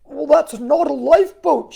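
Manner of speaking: loud shout; a hammed-up shout. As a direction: yell back an objection. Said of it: "shouted Buddy".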